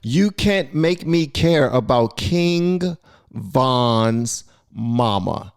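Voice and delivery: drawn out slowly, word by word, echoing the clip; slow mocking repeat. Vlogger repeating the rant to viewers, slow and mocking. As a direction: repeat it back slowly to mock him. You heard that?.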